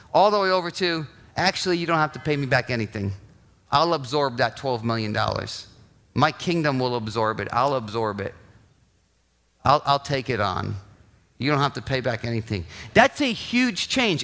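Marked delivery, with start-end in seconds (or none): 1.4-10.8 gentle king forgiving. Acted. 11.4-12.7 low, soft and gentle; a king quietly waving away the debt, soft and low; gentle forgiving king. Acted.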